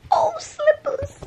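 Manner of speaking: high, slow cooing. Real, not acted. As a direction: coo at a pet, thrilled. Spotting the pet.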